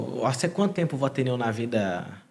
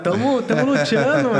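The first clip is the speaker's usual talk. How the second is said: animated protest; 11 semitones higher, 6 dB louder than usual. Real, not acted. Guest struggling with Spanish, animated.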